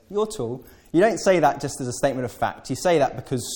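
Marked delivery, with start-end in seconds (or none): 0.1-0.6 high, coy hint; hinting flatterer. Retold or imitated. Congregant hinting to Peter, high coy voice. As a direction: drop the hint sweetly. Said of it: "you've walked up to me and said".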